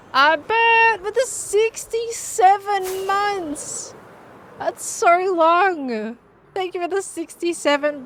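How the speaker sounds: very slow, high and loud; drawn-out awe. Real, not acted. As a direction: stretch every word out. Dragged out chat greeting.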